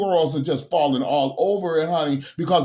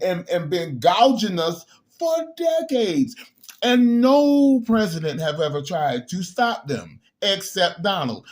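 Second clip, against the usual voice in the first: ordinary talk, nothing marked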